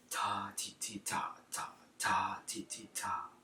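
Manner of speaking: whispered rhythm counting; whispering. Shown as a demonstration. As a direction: whisper it, piano. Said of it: "and then I might go back".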